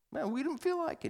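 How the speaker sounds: high, soft grumble; murmuring kids. Retold or imitated. Kids murmuring in the van about shopping, high sulky grumble. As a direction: mutter a sulky kid's complaint. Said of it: "they kinda murmur".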